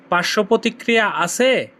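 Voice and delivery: ordinary talk, nothing marked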